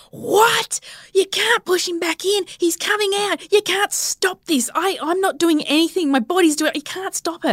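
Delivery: very high mock scream, held back in volume; retold screaming protest. Labouring mother told baby will be pushed back, retold screaming protest. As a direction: act out shrieking disbelief without full loudness. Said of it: "screaming like a beast".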